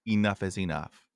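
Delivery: ordinary talk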